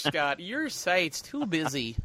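slow Midwestern complaint; Midwestern accent. Pretend. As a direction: drawl a Midwestern gripe. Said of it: "Oh, gosh".

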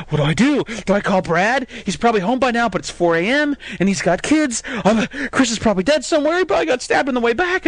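mock panicked rambling; panicked inner monologue. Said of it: "and I'm like".

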